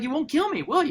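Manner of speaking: very high-pitched mock disbelief; incredulous Joker. Professor as villain to the hero who saved him, high incredulous question. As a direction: squeak it out as a baffled villain. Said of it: "And the Joker's incredulous".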